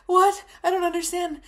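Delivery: high, loud startled "What?"; shocked confusion. Retold or imitated. To grandma, a high, loud startled reply. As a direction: blurt it out, completely thrown.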